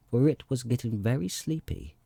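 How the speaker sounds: usual speaking voice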